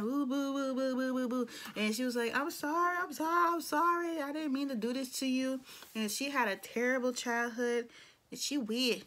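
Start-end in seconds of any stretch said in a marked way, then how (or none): 0.0-1.5 mock boo-hoo. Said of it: "she started crying". 2.3-5.6 high, tearful mock sobbing apology; mock crying. Imitating the crying catfisher apologizing to the guy, high and tearful. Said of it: "she started crying".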